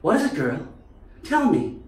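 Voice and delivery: high, eager Lassie owner voice. Pretend. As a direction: coax the dog like in Lassie. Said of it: "more like that lassie".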